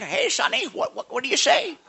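put-on high, loud creaky old man straining to hear. Pastor imitating a deaf old man, high and loud. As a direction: play a half-deaf old man cupping his ear. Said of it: "Like, like an old man".

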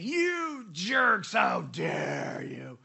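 drawn-out, hammed-up growling shout; slow-motion mock rage; mock angry outburst. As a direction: stretch each word out in exaggerated outrage. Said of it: "reacting in anger, right, and saying".

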